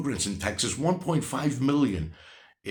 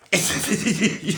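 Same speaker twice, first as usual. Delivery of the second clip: a loud burst of genuine laughter; real laugh; 6 semitones higher, 5 dB louder than usual. Real, not acted. Ranting vlogger laughs at a commenter's argument, loud real laughter. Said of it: "I'm, I'm laughing".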